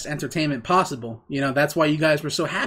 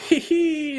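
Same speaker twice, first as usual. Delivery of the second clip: high teasing hee hee; mock giggle; 12 semitones higher than usual. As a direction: giggle like a smug trickster. Mocking giggle after quote.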